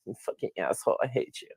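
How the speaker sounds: a hint of a bitter maxi, kept quiet; mock hatred, said under the voice; Bitcoin maxi. Imagining a rejected guy hating a rival, quiet mock-bitter maxi voice. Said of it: "That's the Bitcoin Maxi voice".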